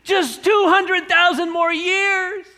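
high, slow, naive sarcasm; mock answer. Preacher mocking the debtor's naive plea, sarcastic. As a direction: play the debtor's absurd request. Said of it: "how much time do you need?".

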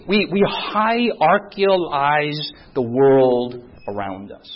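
normal talking voice

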